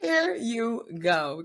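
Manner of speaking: very slow, high "here you go"; drawn-out promo line. Acted. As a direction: stretch every word out like a slogan.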